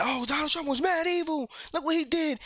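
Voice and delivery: a high, mocking imitation of outraged media. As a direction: lightly parody the scandalized pundits. Mocking propaganda machines' voice.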